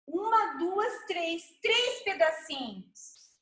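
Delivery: usual speaking voice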